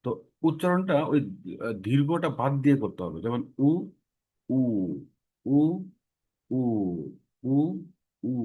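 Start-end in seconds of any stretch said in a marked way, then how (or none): 3.6-8.3 short clear উ, said without lengthening; teacher's short উ drill; vowel demo. Shown as a demonstration. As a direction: repeat উ short and crisp, no drawl. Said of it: "উচ্চারণটা এমন হবে".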